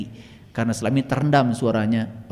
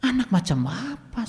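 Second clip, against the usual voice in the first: high reproach, kept softer; scolding; 10 semitones higher, 5 dB quieter than usual. Retold or imitated. Healer asks patient what kind of child this is, scolding. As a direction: reproach them with incredulity, no shouting. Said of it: "Saya omelin".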